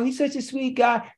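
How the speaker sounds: high, sing-song mock admiration; mock sweet voice. Preacher mocking people's gushing, in a high sing-song voice.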